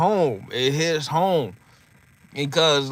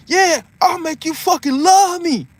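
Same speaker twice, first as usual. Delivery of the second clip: much higher, louder cocky boast; Mike impression; 14 semitones higher, 4 dB louder than usual. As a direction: brag like Mike at a press conference. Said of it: "it was like".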